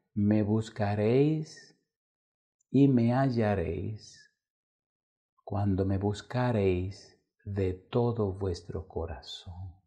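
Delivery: slow, measured recitation; unhurried preaching of a verse; solemn scripture reading. Acted. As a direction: read it like a sacred promise.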